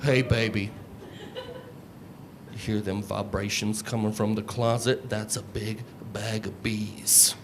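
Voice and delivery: hushed low creepy seduction; creepier come-on. Retold or imitated. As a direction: lean in low like a creep with a secret. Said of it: "As opposed to".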